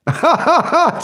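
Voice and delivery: a sudden high laugh bursting out at the joke; high burst of laughter. Real, not acted. Streamer laughing high at a viewer's pudding pun.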